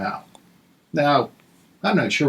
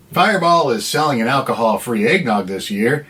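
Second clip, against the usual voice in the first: ordinary delivery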